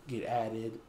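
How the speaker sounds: ordinary talk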